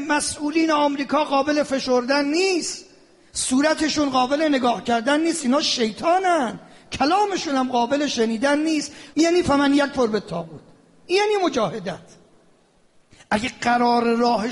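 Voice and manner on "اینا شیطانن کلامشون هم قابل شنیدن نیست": usual speaking voice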